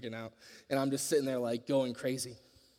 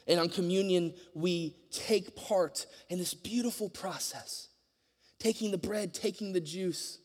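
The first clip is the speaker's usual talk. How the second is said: higher, fervent preaching voice; 6 semitones higher, 4 dB louder than usual. Acted. Preaching to the congregation about communion, fervent and raised. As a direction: lift it like a pulpit appeal.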